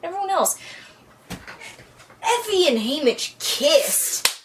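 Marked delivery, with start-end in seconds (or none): 1.3-4.4 slow, drawn out, shouted news; excited announcement. Acted. Fan telling friend the big news, excited shout.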